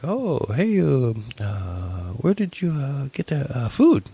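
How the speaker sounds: halting broken English, high-pitched starts. Retold or imitated. Dude asking where she got food, broken English. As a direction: mimic a hesitant foreign speaker struggling in English. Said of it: "speaks real broken English".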